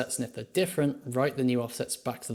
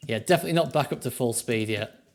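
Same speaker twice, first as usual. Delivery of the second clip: ordinary talk, nothing marked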